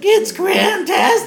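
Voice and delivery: put-on very high squeaky kid voice. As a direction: squeak it out like a pimply teen. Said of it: "pimply kid with a squeaky voice".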